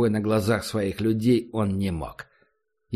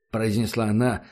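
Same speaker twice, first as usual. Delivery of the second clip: plain reading voice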